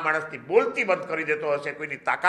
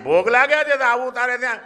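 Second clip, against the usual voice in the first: raised, louder comic retort; mock exasperated retort; 8 semitones higher, 7 dB louder than usual. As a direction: toss it back with higher, louder exasperation. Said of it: "મેં કીધું".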